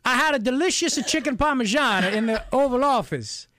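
a cartoonish Italian priest accent, stretched out; Guido Sarducci Italian accent. Host mocking the Italian prime minister in a hammed-up Italian accent. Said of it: "hoping that he would sound like Guido Sarducci".

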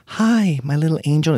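put-on high, doting mom voice to her kid; mother cooing to her child, pitched high; Amy Schumer doting mom. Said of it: "Amy Schumer talking to her kid saying".

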